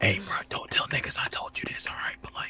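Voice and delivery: very soft conspiratorial whisper; furtive whisper. Acted. Dealer telling a buyer to keep it secret, furtive whisper. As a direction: whisper so nobody else catches on.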